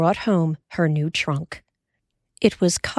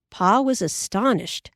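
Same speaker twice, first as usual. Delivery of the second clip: ordinary delivery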